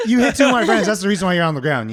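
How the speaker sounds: half-laughing angry comeback, fast; heated retort. Retold or imitated. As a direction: laugh it off while snapping back at him. Retold heated argument with attacker.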